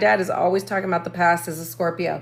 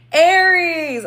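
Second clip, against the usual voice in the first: cracking, higher and louder; pubescent voice crack; 7 semitones higher, 5 dB louder than usual. Real, not acted. Host retries the sign name, voice cracking like puberty. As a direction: push the word out on an unsteady high voice. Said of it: "I feel like I'm going through puberty".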